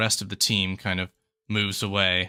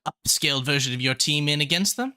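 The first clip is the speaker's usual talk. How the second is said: high-pitched, louder professor; 5 semitones higher, 3 dB louder than usual. Acted. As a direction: lift the voice well up.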